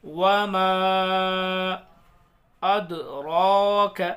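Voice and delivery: slow, loud chanted recitation; tajweed recitation, held vowels; Quran recitation. Shown as a demonstration. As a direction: stretch each word melodically. Recitation of verse.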